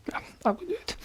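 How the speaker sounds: light, very high pure falsetto. Shown as a demonstration. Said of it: "is the pure falsetto tone".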